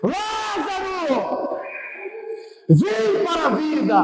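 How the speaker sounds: high, long-drawn call to the dead; preacher acting out a summons; summoning call. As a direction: call out slowly, pitched high and falling. Jesus calling Lazarus from tomb.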